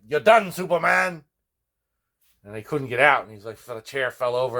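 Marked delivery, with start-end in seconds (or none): 0.1-1.2 slow, higher, louder gloating cry; mock triumphant yell. Kids gloat at their cousin tied to a chair, yelling. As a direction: crow over the tied-up hero. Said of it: "we're like".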